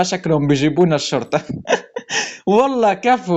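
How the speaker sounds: normal talking voice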